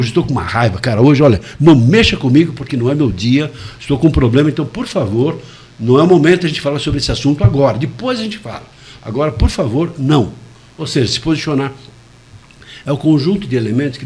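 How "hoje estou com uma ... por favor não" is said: louder, firm irritated warning; mean it, push the words out annoyed; firmly annoyed, telling someone to back off; congruent anger. Shown as a demonstration. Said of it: "tem que ter congruência".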